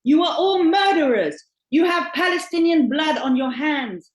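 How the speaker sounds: loud, high-pitched accusation, short of screaming; retold scream. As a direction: read out an attacker's shouted accusation. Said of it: "scream at the top of their lungs".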